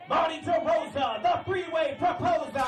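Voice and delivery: high, slow hype shout; announcer shout. Pretend. Comic reading his nickname list, announcer shout. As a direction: boom it like a ring announcer.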